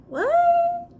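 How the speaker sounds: high, loud shocked yelp; shocked what. Retold or imitated. To the creepy team lead, a shocked loud what. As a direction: blurt it out stunned and high. Shocked reply to rude question.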